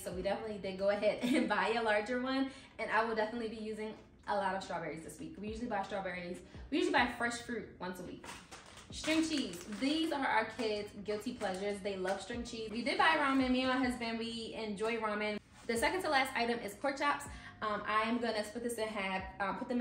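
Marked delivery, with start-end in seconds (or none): none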